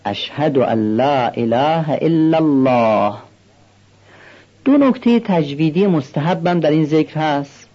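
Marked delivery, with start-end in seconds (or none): 0.0-3.1 اشهد ان لا اله الا الله recited slowly, with each hamza clear; slow careful recitation. Shown as a demonstration. Teacher modelling the testimony for students, slow and careful. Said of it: "بدون افراط باشد".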